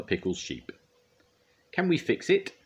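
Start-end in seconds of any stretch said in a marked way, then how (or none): 1.7-2.5 high rallying call. A narrator's reading. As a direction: call out brightly to the whole crew. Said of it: "Scoop called to everyone".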